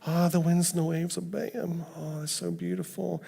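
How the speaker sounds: high, soft, dreamy gushing; mock worship rapture. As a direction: swoon over a lovely lyric in imitation. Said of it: "we sing it and like".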